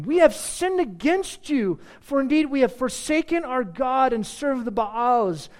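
a penitent people begging God; pleading Israelites. Acted. Voices Israel's confession in reading.

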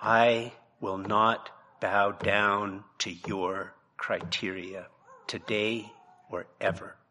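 a tender, dismayed refusal; with compassion. Shown as a demonstration. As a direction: lay it down gently, sorrowful but firm. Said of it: "Said with compassion".

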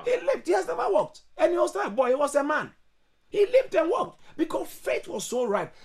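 high-pitched, amazed exclamation; thrilled, high pulpit storytelling; excited preaching. Real, not acted. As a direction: marvel at the miracle, voice right up high. Marvels at the healing story.

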